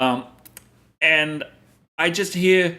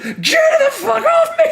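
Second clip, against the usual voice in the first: much higher, louder, slow laughing yell; mock panicked yell; 9 dB louder, slower than usual. Imitating his partner yelling at a stink bug, high laughing shriek. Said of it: "She's like".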